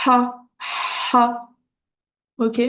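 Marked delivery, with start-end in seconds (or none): 0.1-1.5 isolated "ha" with a strong pharyngeal H; throaty Arabic ha. Shown as a demonstration. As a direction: push the H of "ha" out from the back of the throat. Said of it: "qui sort vraiment du fond de la gorge".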